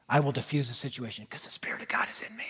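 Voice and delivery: fast, low whisper; thinking to himself. Retold or imitated. Preacher to himself before switching seats, whispered inner thought. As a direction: whisper it quickly as a private thought. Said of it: "I'm thinking to myself".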